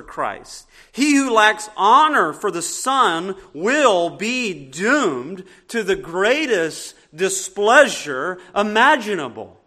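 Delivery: full-force preaching. Acted. Pastor warning the congregation about reverence, full-force preaching. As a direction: warn the flock gravely.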